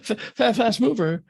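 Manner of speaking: high, stammering startled gasp; mock panic, high and rushed from a gasp; retold panic. Sleeper jolted awake fearing a torpedo, retold high panic. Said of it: "I wake right up thinking".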